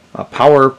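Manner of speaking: normal talking voice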